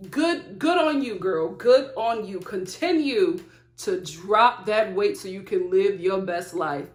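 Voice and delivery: ordinary talk, nothing marked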